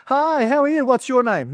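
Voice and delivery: high, singsong hello to a stranger; chirpy greeter. Pretend. Preacher acting out meeting someone with an odd name, high mock greeting. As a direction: put on a high-pitched friendly introduction.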